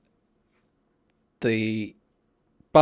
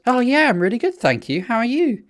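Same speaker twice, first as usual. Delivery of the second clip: much higher, eager chirpy voice; over-keen stallholder; 12 semitones higher, 3 dB louder than usual. Retold or imitated. Stallholder latching onto a visitor, mock over-friendly. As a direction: mimic a stranger far too keen to chat.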